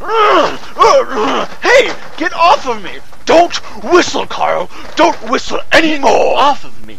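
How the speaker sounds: high, very loud yelling in a struggle; frantic scuffle yells; furious shouting. Acted. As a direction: shout him off you in a panic. Scuffle over whistling.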